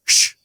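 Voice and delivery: loud, sharp "shh" to hush someone; sharp shush. Retold or imitated. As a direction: shush noisy kids in a cinema. Shushing noisy teens in theater.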